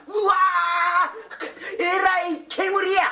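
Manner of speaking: high drawn-out startled cry. Acted. Fool startled by the steam from the bowl, crying out. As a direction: recoil in mock alarm.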